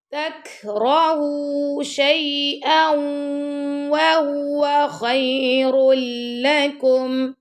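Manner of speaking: very slow melodic chant; Quran recitation. Shown as a demonstration. Teacher recites for pupils, slow melodic chant. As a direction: stretch the vowels in recitation. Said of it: "teacher demonstrates recitation".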